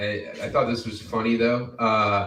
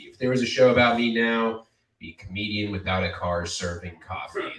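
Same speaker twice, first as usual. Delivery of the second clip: ordinary talk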